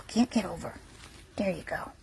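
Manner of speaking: soft unvoiced whisper to a puppy. Real, not acted. Helping a puppy too small to climb over, whispering. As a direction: breathe the words to a tiny puppy.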